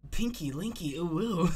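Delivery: cutesy character catchphrase; twinkling voice. Shown as a demonstration. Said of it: "That, I got it right".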